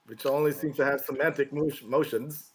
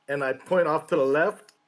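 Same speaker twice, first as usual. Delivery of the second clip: usual speaking voice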